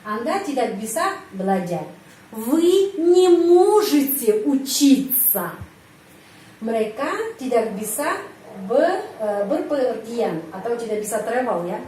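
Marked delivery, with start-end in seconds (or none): 2.3-5.6 slow, louder, stress on не; slow model sentence. Shown as a demonstration. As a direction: read each word out slowly for the class.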